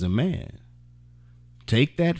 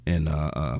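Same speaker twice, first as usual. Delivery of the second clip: ordinary delivery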